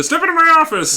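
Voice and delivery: fast, high, silly boss voice; hammy boss voice. Pretend. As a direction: ham up a gleeful boss. Hammed boss voice.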